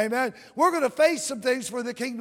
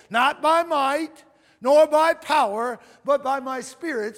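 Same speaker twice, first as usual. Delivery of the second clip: slow and louder, hammering each phrase; preaching proclamation; 2 semitones higher, 6 dB louder, slower than usual. Acted. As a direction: proclaim it to the whole church. Proclaims scripture at full force.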